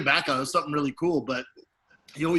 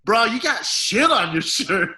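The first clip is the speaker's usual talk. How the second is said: higher, louder, slower announcement; loud, slow call-out; 6 semitones higher, 5 dB louder, slower than usual. Retold or imitated. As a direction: spell out the bad news loudly. Friend points out the shit.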